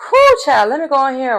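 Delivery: high exclaimed sigh of reluctance; mock reluctance. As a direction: imitate a slacker dragging himself into action. Acts out Miles reluctantly giving in.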